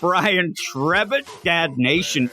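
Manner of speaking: hyped shout-out roll call; roll call announcer. Acted. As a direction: read the names like a hype man. Hypes roll call over music.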